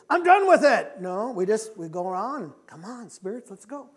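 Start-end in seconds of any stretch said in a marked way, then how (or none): 0.1-1.0 high-pitched, full-force shout; emphatic shout. Acted. Preacher voicing what we don't say, emphatic shout. Voicing refusal the congregation never makes. 2.7-3.9 soft, offhand coaxing; casual invitation. Acted. Preacher mimicking someone taking the spirit along, soft offhand invite.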